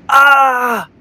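high drawn-out "ah" yell; echoed scream. A narrator's reading. Echo answers the boy from a distance, screaming. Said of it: "he hears in a distance".

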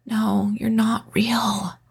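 frightened whispered whimper; a terrified woman whispering denial. A narrator's reading. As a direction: barely breathe the words in fear. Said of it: "I whimpered".

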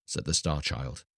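plain reading voice

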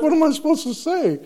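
very high, comically helpless protest; mock defensive. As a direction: imitate a friend squeaking out an excuse. Friend defends himself in retold exchange.

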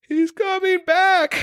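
slow, high-pitched mock wail; hammed-up panicked cry; imitated terror. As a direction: imitate her dread, stretching each word up high. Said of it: "I hear her say".

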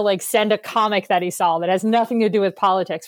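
normal talking voice

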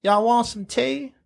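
high, slow sugary Southern drawl; Southern belle. Pretend. Mock Southern lady welcoming a guest, high drawl. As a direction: play a sweet Southern hostess.

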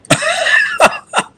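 sudden high, loud disbelieving laugh; a real incredulous laugh; burst of laughter. Real, not acted. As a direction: laugh out at the absurdity. Reacting to absurd letter.

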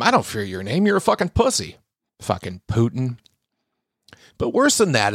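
ordinary talk, nothing marked

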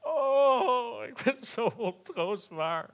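high, loud wailing lion; a sobbing lion's lament; crying. A narrator's reading. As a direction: wail it out in tears. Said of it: "huilde hij".

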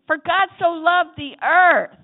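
slow, loud call for the congregation to echo; call to repeat. Shown as a demonstration. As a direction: lead the crowd in the verse. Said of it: "Say it with me".

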